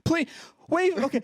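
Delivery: high-pitched frantic pleading; mock panic. Panicked caller begging over the phone instructions, mock panic.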